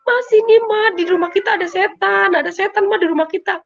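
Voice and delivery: high, urgent frightened plea; retold panic. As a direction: re-enact alarm, calling mom repeatedly. Reporting a ghost to mother. (menirukan anak panik memanggil mama)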